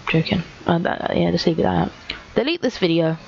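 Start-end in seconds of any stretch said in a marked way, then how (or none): none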